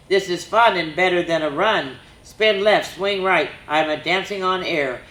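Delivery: put-on bouncy rhyming read-aloud for kids; lively story reading. A narrator's reading. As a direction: read it like a picture book to children. Reads a rhyming reindeer story.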